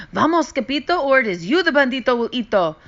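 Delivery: high, loud, fast warning; panicked cry. Acted. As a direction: yell it while fleeing. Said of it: "they cried".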